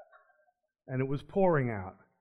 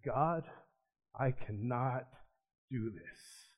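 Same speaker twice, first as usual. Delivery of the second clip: tearful plea; 3 semitones lower, 7 dB quieter, slower than usual. Retold or imitated. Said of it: "and I just burst out crying".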